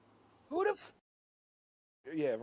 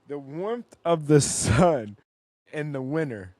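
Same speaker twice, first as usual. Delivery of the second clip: slow reading aloud; 14 dB louder, slower than usual. Real, not acted. Reads the definition aloud.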